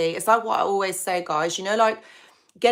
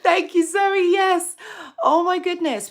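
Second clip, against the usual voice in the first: squealing delight; 10 semitones higher, 4 dB louder than usual. Real, not acted. Thrilled by a viewer's comment.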